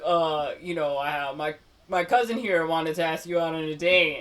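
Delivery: lightly put-on go-between voice. Teenage cousin asks her out for Usnavi, in a put-on voice. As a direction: imitate a kid putting on a voice. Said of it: "puts on, like, a voice".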